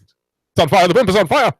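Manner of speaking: high, loud mock-panicked newsreel reporter; distressed broadcast voice. Host imitating the Hindenburg reporter, distressed broadcaster. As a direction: cry out in alarm but stay in broadcast voice. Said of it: "he sounds distressed, but he's still using the broadcast voice".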